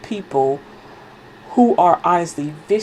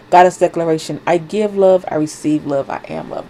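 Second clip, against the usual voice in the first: louder card reading, a proclaiming voice; reading card declaration; 5 dB louder than usual. Acted. Sharing the goddess declaration with her viewers, in a louder proclaiming read.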